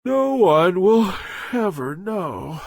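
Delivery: trailing into a yawn; sleepy yawning mumble. Acted. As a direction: mumble it half asleep, fading out. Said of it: "mumbling as he yawned".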